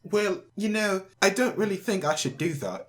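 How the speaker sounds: put-on British accent on request. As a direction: play a polite, hesitant Brit. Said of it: "Do a British accent".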